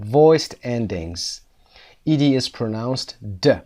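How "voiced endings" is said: voiced drawn out slowly; slow, careful. Shown as a demonstration. Teacher spells out the term for students.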